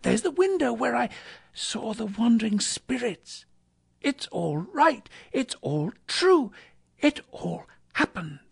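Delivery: high-pitched, marvelling Scrooge; giddy relief, pitched well up; excited wonder. A narrator's reading. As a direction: point out each thing in high amazement. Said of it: "cried Scrooge, starting off again".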